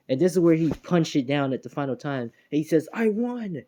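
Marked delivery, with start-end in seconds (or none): 2.9-3.6 mock victory cry. Deku after the final punch, high mock victory cry. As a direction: imitate a winning shout at normal volume.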